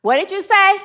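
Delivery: high, incredulous comeback; disbelieving question. Acted. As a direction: balk at an impossible order. Man balks at God's order.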